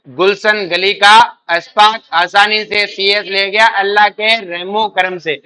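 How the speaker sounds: slow, drawn out word by word; spaced-out teacher's recitation; slow mnemonic recitation. Shown as a demonstration. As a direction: recite the memory line slowly and deliberately. Recites a memory mnemonic for students.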